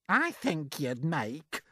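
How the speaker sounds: a hint of a sneering aunt, slow; lightly voiced spiteful aunt. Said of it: "Aunt Spiker said".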